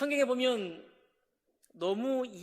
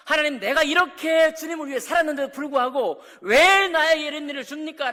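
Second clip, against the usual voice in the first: loud, raised plea to God; protesting demand; 5 semitones higher, 10 dB louder than usual. Retold or imitated. A believer confronting God, loud and raised. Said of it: "라고 따져 묻는 사람에게".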